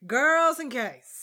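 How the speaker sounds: loud, high, drawn-out address to the audience; mock announcer. Podcaster heralding her story to the listeners, mock announcer. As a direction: announce it grandly, stretching the words.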